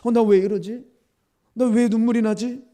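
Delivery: loud, high, repeated puzzled questions; bewildered self-questioning. Retold or imitated. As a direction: relive the confusion at your own tears. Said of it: "계속 눈물이 쏟아지는 거예요".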